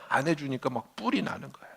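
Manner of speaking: ordinary talk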